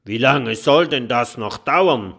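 loud, impatient robber; furious shout. A narrator's reading. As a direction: call out in angry impatience. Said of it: "ist wütend und ruft".

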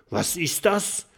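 high, startled cry; alarmed as the ground shakes; startled call. A narrator's reading. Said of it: "ruft Mathilda".

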